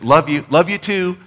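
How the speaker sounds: quick, high, loud throwaway; offhand love you. Retold or imitated. Preacher mimicking a rushed phone goodbye, high and loud. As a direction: toss it off like a routine goodbye. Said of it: "It's like".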